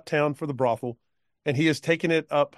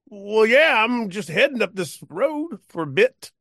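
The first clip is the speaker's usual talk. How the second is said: put-on drunk cowpoke; 5 semitones higher than usual. Drunk cowpoke offering the woman a ride, high and loose. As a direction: slur it like a tipsy old man.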